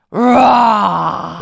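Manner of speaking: loud roaring like a lion. Acted. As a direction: roar like a lion. Ogre turns into lion.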